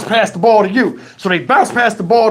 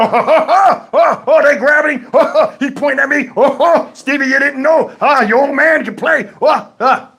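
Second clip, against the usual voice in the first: high, gloating whoops of laughter; mock triumphant old dad's belly laughs; imitated laughing; 5 semitones higher than usual. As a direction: crow with laughter through the words. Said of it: "he start laughing".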